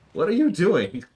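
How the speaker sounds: high, loud startled question. Real, not acted. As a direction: call out in surprise. Dad calling out to child.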